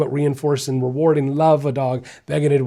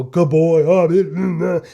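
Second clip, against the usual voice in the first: louder, higher, gushing over the dog; overdone gushing praise; 3 semitones higher, 5 dB louder, much slower than usual. Shown as a demonstration. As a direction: drag the praise out with fussy sounds. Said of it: "I don't go".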